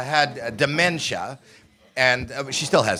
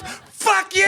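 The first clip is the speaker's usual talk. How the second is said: demented mom's furious yell. Retold or imitated. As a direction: bark it at your son like an angry old mom. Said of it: "but it ended with".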